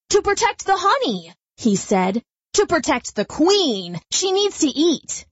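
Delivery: defensive bee. Acted. Billy defends himself.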